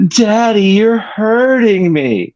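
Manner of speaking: put-on high, drawn-out child's whine; whiny little girl. Father retelling daughter's complaint, high whiny child voice.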